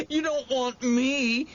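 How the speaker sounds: high, slow sobbing plea. A narrator's reading. Boy sobbing to the monster to spare him. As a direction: sob it out, begging. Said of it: "he sobbed".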